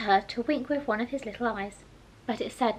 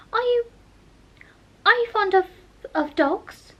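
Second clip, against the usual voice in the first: flustered hurry; 10 semitones higher, 5 dB louder than usual. Acted. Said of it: "in a great hurry to change the subject".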